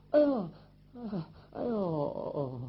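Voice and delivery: wounded man's aching "哎呦" cries; groans of pain. Acted. Injured brother tries to rise from bed, pained groans. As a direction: moan like a wound has torn open.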